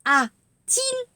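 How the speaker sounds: "a-t-il" with a clear t sound in the middle; linking t. Shown as a demonstration. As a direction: sound the t between "a" and "il" in "a-t-il".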